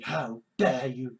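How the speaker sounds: very low, restrained mock outrage; low-pitched, contained pretend anger; earnest indignation. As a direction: say it low, as if genuinely affronted. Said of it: "just genuinely".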